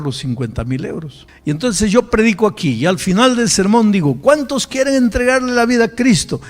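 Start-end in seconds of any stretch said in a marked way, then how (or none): 1.4-6.4 loud, raised-pitch sermon delivery; full-force preaching. Real, not acted. Preacher making an altar call, full-force preaching. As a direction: project it out to the whole congregation.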